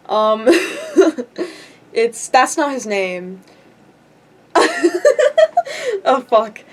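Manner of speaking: real laughing fit after a slip. Real, not acted. Speaker catches own slip of someone's name, laughing. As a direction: crack up helplessly at your own blunder.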